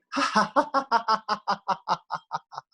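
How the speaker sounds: high, open belly laugh; demo laugh. Shown as a demonstration. Laughter teacher showing the class how to laugh. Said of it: "Even if you fake it".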